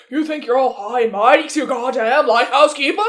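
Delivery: hammed-up old-time lighthouse keeper accent; Robert Pattinson. Quoting the film to co-hosts, imitated lighthouse keeper accent. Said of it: "Robert Pattinson's accent in this movie".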